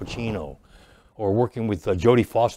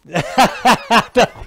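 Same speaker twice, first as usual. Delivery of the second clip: a big high burst of laughter; loud high laugh; 11 semitones higher, 6 dB louder than usual. Retold or imitated. Laughing before Eric's outburst.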